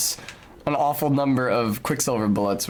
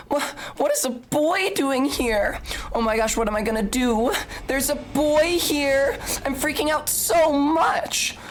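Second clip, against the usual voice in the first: much higher, lovestruck fangirl losing her cool; high giddy fangirl, freaking out then swooning; 8 semitones higher than usual. Pretend. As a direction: gush it out like a boy-crazy fan. Reading a paid text in voice.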